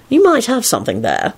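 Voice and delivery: thoughtful. A narrator's reading. Lupin weighing Quentin's suggestion, thoughtful. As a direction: muse on it. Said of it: "Lupin replied thoughtfully".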